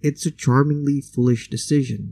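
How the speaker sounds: normal talking voice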